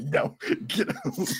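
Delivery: slow retold dismissal through laughter; laughing mock dismissal. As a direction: dismiss the child while giggling helplessly. Retelling a rejection while laughing.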